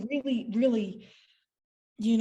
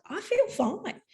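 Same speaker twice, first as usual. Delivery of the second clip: high reply; 11 semitones higher, slower than usual. Retold or imitated. Answering her cousin.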